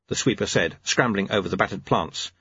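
ordinary delivery

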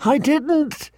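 high, frantic protest; panicked cry. Acted. Boy crying out from his dream, panicked. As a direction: cry out high as if caught in a nightmare. Waking terrified from a nightmare.

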